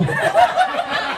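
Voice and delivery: giggle. Real, not acted. Comic laughs at own punchline.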